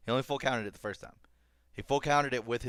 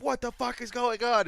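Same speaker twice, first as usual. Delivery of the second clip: high-pitched comic disbelief; exaggerated confused yelp; mock bewildered outburst; 12 semitones higher, 4 dB louder than usual. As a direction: cry out as a baffled fighter. Retold opponent's confusion.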